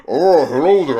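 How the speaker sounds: muffled masked villain voice; Bane through a mask. Retold or imitated. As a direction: speak as if through a full face mask. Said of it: "I sound like Bane talking through the mask".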